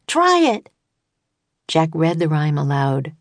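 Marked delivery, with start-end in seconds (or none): 0.1-0.7 high urgent call. A narrator's reading. Annie calling out to Jack, very high. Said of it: "she called".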